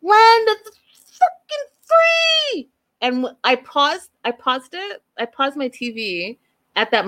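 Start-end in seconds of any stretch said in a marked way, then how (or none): 0.0-2.7 very high, louder, slowing mock scream; high-pitched shouted parody of a proud American rant. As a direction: screech it in his face like the wife. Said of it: "She starts screaming in his face".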